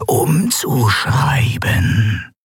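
very slow, drawn-out otherworldly voice; Sylravan. Acted. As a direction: stretch each word, solemn and ominous. Sylravan spricht, unheimliches Wesen. (unheimlich gedehnte Wesensstimme)

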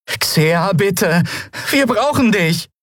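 high-pitched desperate plea; urgent pleading. Acted. Boy begging his paralysed companion to help, urgent pleading. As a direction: beg her for help.

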